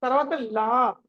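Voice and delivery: normal talking voice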